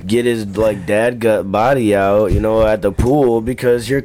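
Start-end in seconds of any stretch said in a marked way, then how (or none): none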